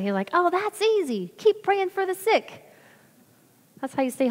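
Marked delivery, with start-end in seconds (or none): 0.3-1.3 high, slow Southern drawl; Southern accent. Retold or imitated. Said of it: "In his kind of southern accent". 1.4-2.6 high, slow Southern drawl; Southern accent. Retold or imitated. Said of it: "In his kind of southern accent".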